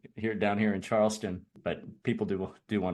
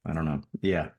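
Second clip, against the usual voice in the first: usual speaking voice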